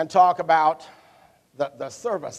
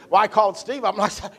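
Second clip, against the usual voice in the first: normal talking voice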